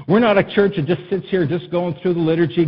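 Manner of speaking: usual speaking voice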